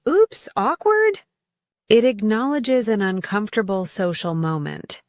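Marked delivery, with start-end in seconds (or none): 0.1-1.2 sheepish awkward. Shown as a demonstration. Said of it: "You'd laugh and say".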